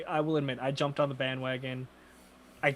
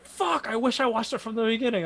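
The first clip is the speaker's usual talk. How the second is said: higher, frustrated coworker lament; a coworker kicking himself, voiced a bit higher; coworker's regret, pitched up; regretful coworker; 8 semitones higher than usual.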